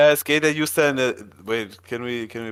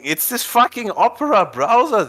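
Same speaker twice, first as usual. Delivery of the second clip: high, frustrated complaint; real irritation, pitched up; exasperated; 8 semitones higher than usual. Real, not acted. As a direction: vent at a misbehaving program. Browser failing during the stream.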